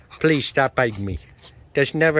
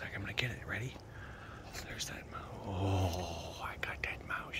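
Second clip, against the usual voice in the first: whisper; 4 semitones lower, 17 dB quieter than usual. Real, not acted. Whispered teasing with toy.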